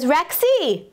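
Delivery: high call to a pet; calling a dog. Retold or imitated. Dog owner summons Rexy to fetch for him, calling out.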